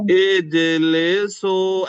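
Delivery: put-on "इजलिसु" stretched slowly as a call to children; drawn-out call. Shown as a demonstration. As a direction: call "इजलिसु" long and slow, like commanding kids to sit. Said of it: "ऐसे आवाज निकालना".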